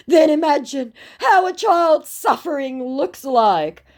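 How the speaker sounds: high, slow guilt-trip whine; milk the pity, drawn out; pitiful scammer voice. Retold or imitated. Scammer guilting the narrator, high slow pitiful whine. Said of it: "he said".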